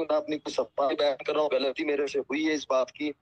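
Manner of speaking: ordinary talk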